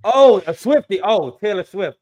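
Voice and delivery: surprised aha; sudden realization. Real, not acted. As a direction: get it all at once.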